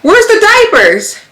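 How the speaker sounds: high, slow stunned question; husband's shock. Retold or imitated. Husband discovering the missing diapers, high stunned question. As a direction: play him finding the diapers gone.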